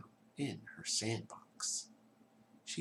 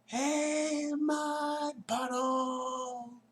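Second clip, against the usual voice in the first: high, loud, very slow drawn-out shout; louder yell; 6 dB louder, much slower than usual. Acted. Girl shouts louder at the hiding mud puddle, long and loud. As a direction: bellow it long across the yard. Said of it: "she yelled even louder".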